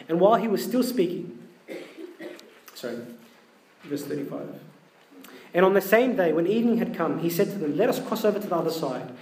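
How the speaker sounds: reading the gospel aloud to the congregation; measured scripture reading. Acted. Priest reads the gospel to his congregation, measured reading.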